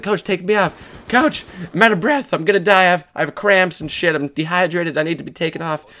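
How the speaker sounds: high, strained call that barely carries; feeble yell. Retold or imitated. Player calling weakly to the coach across the field, high strained yell. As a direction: try to shout across the field but it comes out thin. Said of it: "I couldn't yell very loud".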